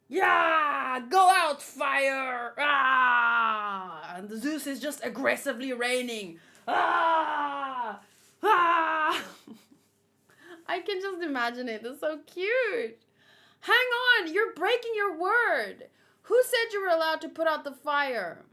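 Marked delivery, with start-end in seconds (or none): 0.1-4.1 loud eager shout; Zeus shouting. Acted. Zeus shouts at the fire while pouring rain, loud. 6.7-9.2 loud shouted ah cries; cartoon cries. Zeus cries out while aggressively raining, loud. 13.6-18.4 indignant villain. Acted. Reading villain's challenge.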